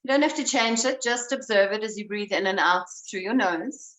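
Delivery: calm, unhurried guiding voice; breath coach easing the class into awareness; guided breathing. Acted. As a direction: lead them gently into noticing the breath.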